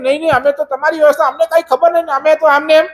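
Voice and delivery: high, fast excuses from the guards; flustered guards. Acted. Guards stammer excuses to the king.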